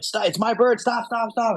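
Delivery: high-pitched urgent pleas to stop; frantic pleading. Retold or imitated. Falconer shielding the bird from the man with a stick, frantic.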